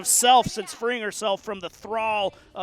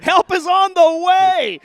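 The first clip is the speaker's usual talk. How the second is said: loud high laughing call; laughing shout; 9 semitones higher, 11 dB louder, slower than usual. Real, not acted. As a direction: yell it through a laugh.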